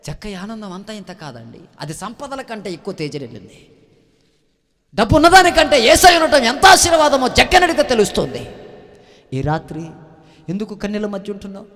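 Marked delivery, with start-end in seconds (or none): 4.9-8.5 loud, high preaching climax, pressing the question; fiery preaching. Acted. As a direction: hammer each question at the congregation. Preaching at full force. (ఉద్వేగంతో గట్టిగా బోధించడం)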